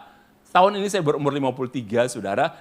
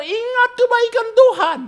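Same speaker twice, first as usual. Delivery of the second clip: high, loud exhortation; preaching Moses; 8 dB louder than usual. Acted. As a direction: proclaim it like Moses to the crowd. Moses answers the people.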